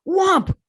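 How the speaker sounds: sharp, high, loud imitated whomp; loud whomp sound. Telling the failed board break, loud imitated whomp. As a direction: slap out the impact noise.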